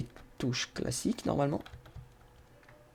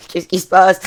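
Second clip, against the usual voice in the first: loud, higher cry of bewilderment mid-laugh; real laughing yell of disbelief; startled outcry; 4 semitones higher, 12 dB louder than usual. Real, not acted. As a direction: shout it out as everything goes haywire. Vehicle goes haywire in the game.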